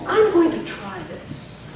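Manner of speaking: slow model phrase, "this" held; teacher's slow drill with a long "this"; last word lengthened. Shown as a demonstration. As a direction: begin high, draw out "try this". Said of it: "the last word gets longer".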